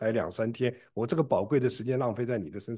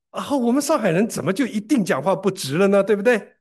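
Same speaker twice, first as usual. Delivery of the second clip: raised, louder indignant comeback, higher pitch; retold indignant retort; 6 semitones higher, 4 dB louder than usual. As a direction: fire the question back, offended. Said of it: "气得我就说".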